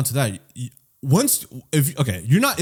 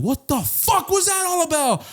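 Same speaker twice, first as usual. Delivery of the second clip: higher, slow indignant voicing; mock angry girl; slower than usual. Rear-ended driver confronting him, mock angry. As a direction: voice her upset demand. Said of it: "She's like".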